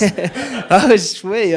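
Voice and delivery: slow words pushed out through real laughter; real laughter over a slow, high reply; laughing. Real, not acted. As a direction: laugh while saying it, high and drawn out. Laughing at the neon wetsuit joke.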